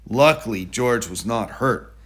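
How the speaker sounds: usual speaking voice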